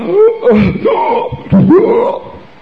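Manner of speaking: loud high panicked gasp; desperate gasp. Retold or imitated. Man begging God for his life, terrified gasp. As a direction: gasp as if choking in terror.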